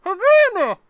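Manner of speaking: high, loud cry from hiding; muffled voice from under the bed. A narrator's reading. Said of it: "A muffled voice under the bed said".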